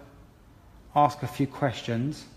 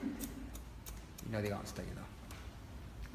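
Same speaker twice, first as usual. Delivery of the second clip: soft, slow, knowing tease; teasing murmur; 3 semitones lower, 13 dB quieter, much slower than usual. Real, not acted. Hairdresser teases a student who knows the answer, in a soft slow murmur. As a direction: coax her quietly into answering.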